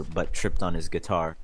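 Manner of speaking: usual speaking voice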